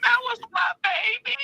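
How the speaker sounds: high, tearful, breaking into sobs; pleading through tears; crying. Real, not acted. Aunt grieving her nephew, crying out to the listeners. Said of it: "got people crying on my podcast".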